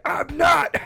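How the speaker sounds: very high, loud outburst; imitated angry shout. Roommate denies he is joking, imitated furious yell. Roommate snaps back in retold quarrel.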